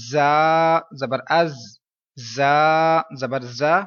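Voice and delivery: very slow زا with a long vowel and buzzing z; whistling letter drill. Shown as a demonstration. Teacher shows students how to spell, slow drawn-out زا. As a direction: spell it out slowly, holding the sharp z in از. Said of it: "اب ہجے کیسے کرنے ہیں سنیے".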